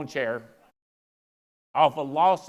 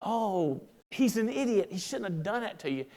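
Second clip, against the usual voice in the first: mock empathy; 6 dB quieter than usual. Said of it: "I'm like".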